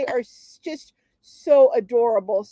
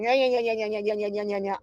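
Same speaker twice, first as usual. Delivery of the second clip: mocking nagging; 4 dB quieter, faster than usual. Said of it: "when Jessica kept going".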